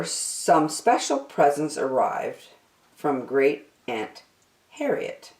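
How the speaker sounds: usual speaking voice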